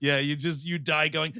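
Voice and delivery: ordinary talk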